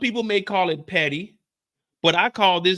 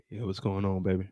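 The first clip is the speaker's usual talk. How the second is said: sexy voice; 11 semitones lower, 8 dB quieter than usual. Retold or imitated. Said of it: "his sexy voice on".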